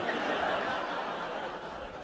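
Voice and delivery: breathy, quiet chuckle under the breath; soft laugh. Real, not acted. Speaker remembering the friend's persistence, soft laugh. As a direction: let out a soft airy laugh.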